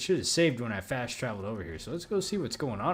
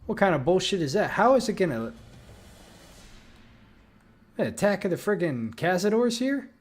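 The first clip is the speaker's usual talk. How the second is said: frustrated yelling; 7 semitones higher, 7 dB louder than usual. Real, not acted. Angry at surprise Cazador attack.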